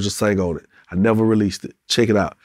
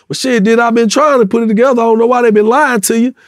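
higher, louder defensive pleading; eager; 10 semitones higher, 5 dB louder than usual. Retold or imitated. Telling [name] he has been trying, higher and louder. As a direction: push back fast to the boss.

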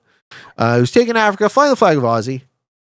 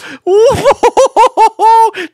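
loud high laugh; 8 dB louder than usual. Real, not acted. Streamer laughing at the quitting player, loud high cackle.

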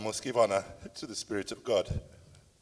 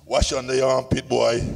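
put-on louder, barked order in a broad Jamaican accent; 6 dB louder than usual. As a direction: imitate a Jamaican dad barking orders. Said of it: "barking at me ... in that broad Jamaican accent".

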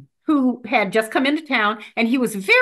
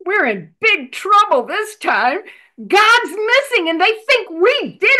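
put-on 1 little boy, worried; 7 semitones higher than usual. Frightened little boy answers.

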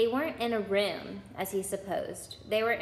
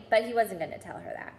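ordinary delivery, nothing marked

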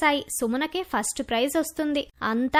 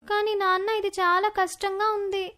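put-on higher-pitched girl voice, mildly pleading; small, raised daughter voice; upset young daughter; 5 semitones higher than usual. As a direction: plead with dad like a hurt schoolgirl. Hurt daughter objects.

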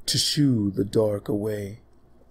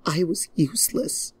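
sad princess; 8 semitones higher than usual. Acted. Princess confesses, soft and high.

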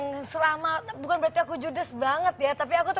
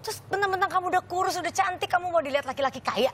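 imitating son's scolding; 7 semitones higher than usual. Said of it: "Maki-maki aku".

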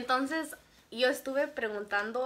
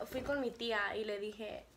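normal talking voice

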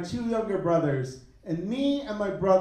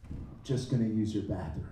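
much lower and softer mumble; junkie brother; 12 semitones lower, 10 dB quieter than usual. Retold or imitated. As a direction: mumble it low like a sketchy brother. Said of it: "be like".

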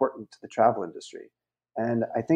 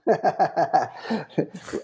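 real burst of chuckling, higher pitched; hearty laugh; 6 semitones higher than usual. Real, not acted. Guest plugging his own academy as a joke, laughing.

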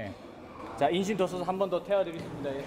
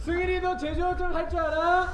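큰 소리로 묻기; 10 semitones higher than usual. Real, not acted. Asking child loudly.

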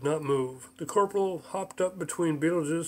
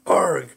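loud barked arg; shouted arg; 2 semitones higher, 8 dB louder than usual. A narrator's reading. Rabbit pounding his fist, loud shouted arg. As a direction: punch out a gruff arg. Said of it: "he shouted".

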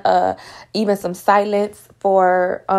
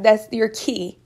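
ordinary delivery

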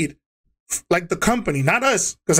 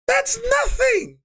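even higher, slow shout with "That's" punched; furious shout; slower than usual. Retold or imitated. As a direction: scream "That's" at the top of the voice. Angry rant at district manager.

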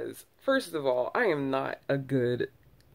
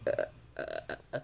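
panicked stammer; 11 semitones lower, 7 dB quieter than usual. Retold or imitated. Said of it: "I was just like".

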